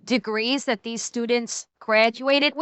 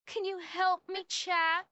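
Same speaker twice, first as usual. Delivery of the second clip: soft, higher-pitched mock begging; mock helpless plea; 4 semitones higher, 6 dB quieter than usual. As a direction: play the helpless victim, very softly. Plays helpless debtor begging chat.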